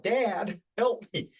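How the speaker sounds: high-pitched cry to dad; acted yell for help. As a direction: call out as a son in trouble. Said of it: "he yells out".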